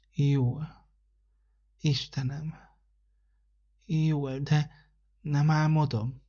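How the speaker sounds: put-on a sick boy who can barely believe it; feverish child. The sick boy answers December, soft and amazed.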